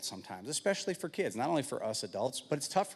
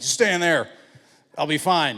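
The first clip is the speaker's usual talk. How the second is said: loud, raised-pitch dad call; 5 semitones higher, 11 dB louder than usual. Retold or imitated. Dad orders boys to stay inside, loud mock reassurance. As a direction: shout to keep the kids back. Acted dad calling off his kids.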